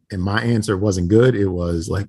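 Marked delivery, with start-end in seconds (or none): none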